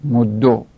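مدو as "moddo", rounded final vowel; damma ending. Shown as a demonstration. Teacher recapping the third form, مدو as "moddo". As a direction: end مدو on a damma matching the mim.

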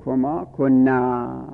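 slow recitation. Shown as a demonstration. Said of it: "این حروف خطاب".

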